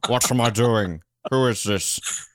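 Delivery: drawn-out, slightly hammed-up actor's questioning; slow mock-confused film star; lightly voiced actor. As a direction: play a baffled star, slowly. Podcaster plays the confused actor.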